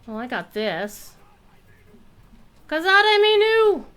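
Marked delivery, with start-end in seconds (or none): 2.7-3.9 high, slow, loud war shout; triumphant war cry; battle cry. Real, not acted. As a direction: bellow the skill name like a battle cry. Calling out skill name in combat.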